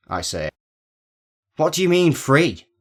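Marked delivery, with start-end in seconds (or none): 1.6-2.7 sharp, high, louder snap; snappish. A narrator's reading. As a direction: bite back in disbelief.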